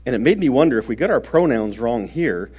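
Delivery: normal talking voice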